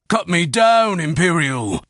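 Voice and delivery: hanging chieftain begging in panic; panicked begging. A narrator's reading. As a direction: beg to be cut loose. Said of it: "he begged, words brimming with panic".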